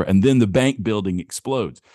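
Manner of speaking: ordinary delivery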